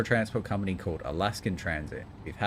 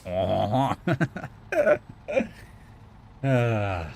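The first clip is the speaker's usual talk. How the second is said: mock French laugh; 2 semitones higher, much slower than usual. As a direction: fake a French "haw-haw" laugh. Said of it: "my French accent is just going".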